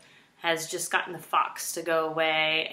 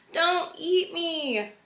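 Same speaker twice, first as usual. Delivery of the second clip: high, slow desperate plea; imploring; 11 semitones higher, much slower than usual. A narrator's reading. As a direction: beg on your knees. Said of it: "she implored".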